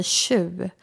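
"tju" said as snobbish people pronounce it; "tju" as a mimicked upper-class pronunciation; imitated snobbish pronunciation. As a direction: imitate a snob's way of saying "tju". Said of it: "de uttalar så".

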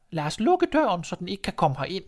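high granny voice, a little urgent; let's keep the wolf out; old grandmother. Acted. Grandmother to Red, high and urgent.

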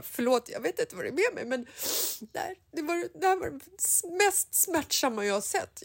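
high, tearful, breaking apology; retold sobbing. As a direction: replay the sobbing at the ticket booth. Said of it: "storbölar". (återberättad storbölande ursäkt, hög och gråtfärdig)

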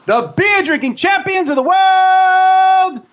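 big circus ringmaster announcement. Acted. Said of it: "ladies and gentlemen, boys and girls".

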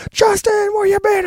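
high, loud shout of his name; loud call across the room. Retold or imitated. New friends hailing Justin with a loud high call. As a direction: yell his name like spotting an old pal.

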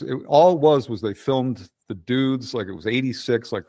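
ordinary talk

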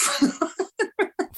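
sudden high-pitched cackle; high laugh burst. Real, not acted. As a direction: crack up at the punchline. Reacting to wanking story.

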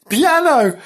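high, loud laughing piano; laughing exclaim. Real, not acted. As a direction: burst out amused. Reader laughs at her own text.

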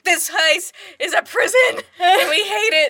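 high, loud whiny wail of the housemates; mock whiny wailing. Friends at dinner complain about house rules, whiny wail. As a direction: wail it like a sulky teen, loud and slow. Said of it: "they're all just like".

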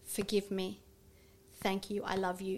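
gently forgive yourself; tender prayer. Shown as a demonstration. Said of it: "Say to that part".

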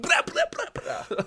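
high-pitched rapid-fire babbled noise; silly celebration noise. Retold or imitated. Gamer mimicking his celebration after helping, high goofy noise. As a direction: blurt it like a goofy victory sound.

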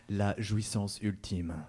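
drawn out slowly; slow dramatic. Acted. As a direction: savour each word slowly.

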